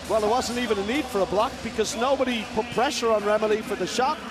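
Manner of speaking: ordinary talk, nothing marked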